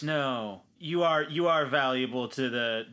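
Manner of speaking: ordinary talk, nothing marked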